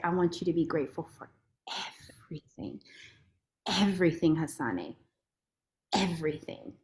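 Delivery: soft low voice sinking to a whisper on everything; voice of Allah. Retold or imitated. Allah telling her to be grateful, soft and hushed. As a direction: fade down to a tender hush. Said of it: "He said".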